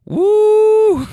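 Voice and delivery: loud, very high "woo"; imitated granny whoop. Granny laughing at her driver, loud imitated whoop. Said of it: "and she laughs, and then she goes".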